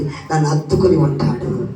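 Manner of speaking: slow and low, longing for her husband; low, slow Leah longing to be held; Leah hoping. Acted. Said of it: "అంటుంది".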